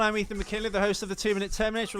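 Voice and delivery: ordinary talk, nothing marked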